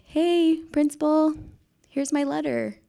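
high, sing-song awkward politeness; mock casual greeting. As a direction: hand it over with put-on lightness. Retold awkward handing over of resignation.

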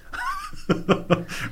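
a sudden burst of laughter, high. Real, not acted. Guest laughing at the odd coincidence, burst of laughter.

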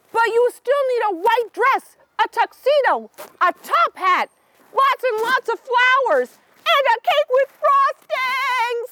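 high, excited buzzing bees. Acted. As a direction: rattle off the list like eager bees. Bees list wedding needs.

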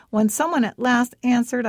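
ordinary delivery

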